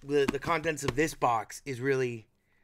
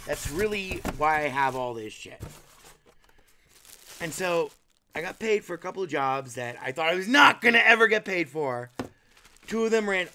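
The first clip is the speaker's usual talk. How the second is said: ordinary talk, nothing marked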